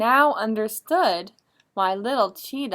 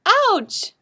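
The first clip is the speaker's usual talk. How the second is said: much higher, louder yelp; cry of pain; 12 semitones higher, 10 dB louder than usual. Acted. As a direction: yelp as the door hits your tail. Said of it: "cried little Cheetah".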